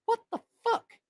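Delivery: very high put-on voice of disbelief; baffled viewer clicking, high put-on disbelief; mock bewildered. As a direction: squeak it out like a baffled stranger. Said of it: "because they were like".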